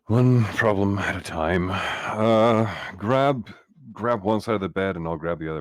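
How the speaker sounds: loud, high, slowed urgent orders; urgent call. Acted. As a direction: shout the plan over the danger. Urgent plan to lift the bed.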